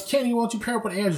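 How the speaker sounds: high, quick organizing voice; camp supervisor. Retold or imitated. Supervisor asking Kenny to take Andrew, brisk.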